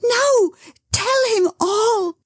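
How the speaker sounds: high, slow pleading through tears; tearful cry. Acted. Dot urging Edward to confess, tearful cry. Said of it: "cried Dot".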